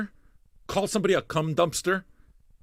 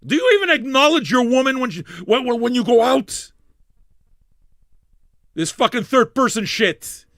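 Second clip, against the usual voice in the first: higher and louder, slowing on the last line, mock outrage; indignant rant; 8 semitones higher, 7 dB louder than usual. Real, not acted. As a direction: tear into the viewer, half joking. Ranting at chatter ignoring him.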